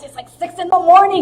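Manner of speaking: loud, high mock exasperation; exasperated friend. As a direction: imitate a friend bothered too early. Imitating friend woken at six.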